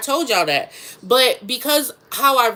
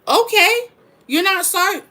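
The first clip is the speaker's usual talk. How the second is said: sarcastic retort; 7 semitones higher than usual. Retold or imitated. Mocking retort to the officer.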